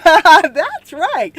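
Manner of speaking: laughing, loud "That's right". Real, not acted. Host answering her own riddle, laughing loudly. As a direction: laugh the words out.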